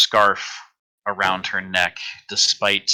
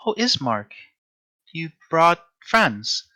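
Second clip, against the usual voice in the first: lightly voiced sweet young woman, a little higher; soft sweet voice; 7 semitones higher than usual. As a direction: greet them with a touch of gentle sweetness. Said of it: "just a soft, sweet voice".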